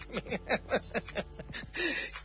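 light, high run of laughter; amused laugh. Real, not acted. Host teasing a listener about mental mix-ups, amused laugh. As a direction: laugh at your own teasing joke.

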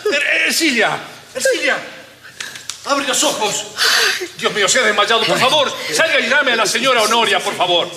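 high-pitched frantic pleading; pánico. Acted. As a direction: beg for help over someone who fainted. Companion has fainted, sends for help. (súplica aguda y desesperada)

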